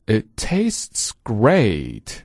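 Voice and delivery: It tastes great drawn out very slowly; slow, deliberate teacher reading; very slow model. Shown as a demonstration. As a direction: stretch every word for learners. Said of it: "并且跟读[name]。".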